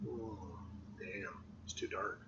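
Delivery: soft disappointed whisper. Real, not acted. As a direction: breathe it out to yourself.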